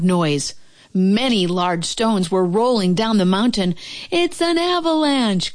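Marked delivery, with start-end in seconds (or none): 4.1-5.5 high-pitched cry of alarm. A narrator's reading. Toad warning Frog of rolling stones, alarmed cry. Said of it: "cried Toad".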